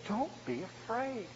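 soft and slow, DONT eased gently down; calm frightened brothers, barely above a breath; kind reassurance. Acted. Joseph soothing his terrified brothers, soft and slow. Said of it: "he reassured them and spoke kindly to them".

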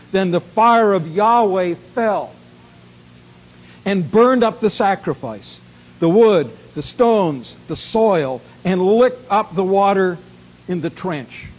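loud, emphatic Bible reading; declamatory Scripture reading. Acted. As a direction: proclaim the fire's fall, loud and deliberate.

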